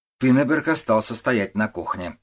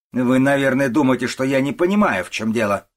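ordinary delivery

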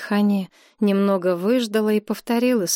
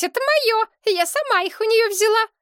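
very high, possessive whine; snappish. A narrator's reading. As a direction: snap back like a cornered squeaky girlfriend. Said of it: "Девица окрысилась". (огрызается писклявым голосом)

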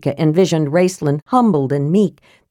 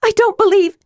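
high-pitched, distraught protest; wild, high disbelief; wild cry; faster than usual. A narrator's reading. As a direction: refuse to accept it, voice shooting up. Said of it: "Tika cried wildly".

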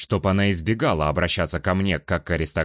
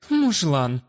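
high, playfully fake huff; mock snort; 8 semitones higher than usual. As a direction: snort with feigned offense. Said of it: "Наигранно фыркнула женщина".

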